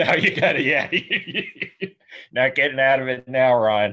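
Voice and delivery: giggling through the words; laughing. Real, not acted. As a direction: laugh while talking. Laughing at the question.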